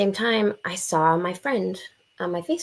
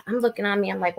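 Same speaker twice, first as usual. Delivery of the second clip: normal talking voice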